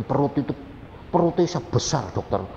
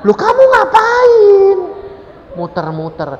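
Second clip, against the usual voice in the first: much higher, loud astonished voice, slow; loud high incredulous husband; shocked husband; 12 dB louder than usual. Retold or imitated. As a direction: exclaim in shock at the wife. Husband finds wife turning around.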